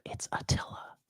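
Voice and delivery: scared hushed whisper of a name. Retold or imitated. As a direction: breathe the name in secret. Caught in bed, hushed.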